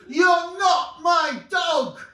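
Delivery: slow, high, loud refusal; exasperated woman shouting. Acted. Woman losing patience with the dog, shouting slowly. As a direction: drag each word out at the top of your voice. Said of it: "At different levels".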